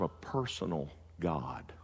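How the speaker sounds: soft sermon whisper. Acted. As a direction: lean in and whisper it.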